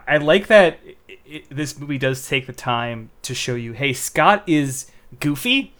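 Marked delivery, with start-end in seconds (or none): none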